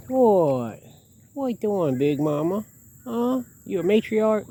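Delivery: high, playful voice for an animal; teasing pet talk. Real, not acted. Chatting to the mother goose, high and teasing. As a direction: tease the bird like a pet.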